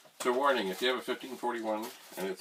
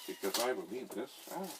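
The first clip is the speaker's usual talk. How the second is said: ordinary delivery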